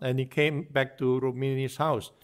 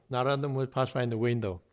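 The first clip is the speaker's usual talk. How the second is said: usual speaking voice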